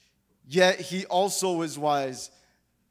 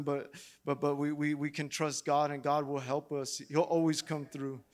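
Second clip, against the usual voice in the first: low, soft, sincere preaching; 3 semitones lower, 5 dB quieter than usual. Acted. Pastor quietly reassures the congregation, soft sincere preaching. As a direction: bring it down low and tender to the flock.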